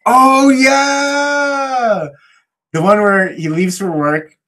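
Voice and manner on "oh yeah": loud, high, long-held exclamation; real burst of delighted remembering; excited recognition. Real, not acted. Friend reminded of the writer storyline, excited recognition.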